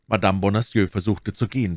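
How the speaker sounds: plain reading voice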